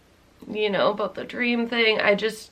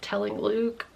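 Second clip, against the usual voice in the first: ordinary delivery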